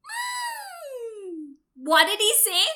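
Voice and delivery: ordinary delivery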